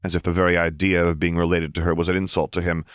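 usual speaking voice

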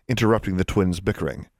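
usual speaking voice

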